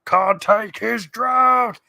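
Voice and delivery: high, loud, drawn-out orc cry; orc jeer. Acted. Orc mocking Merry choking on sludge, high shouted jeer. Acts out the same film orc.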